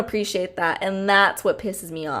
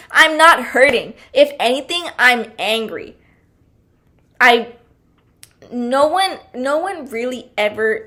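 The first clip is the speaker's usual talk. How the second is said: ordinary talk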